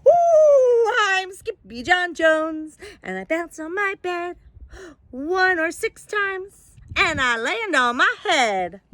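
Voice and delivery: high, bouncy cartoon kitten; silly kitten voice. Kitten bouncing on his bed in a high silly voice. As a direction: play a giddy little cat showing off.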